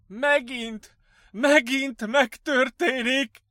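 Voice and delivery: high, loud, slow panicked wail; frantic cry. Acted. Patient raving to his doctor, frantic. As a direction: cry out as it happens all over.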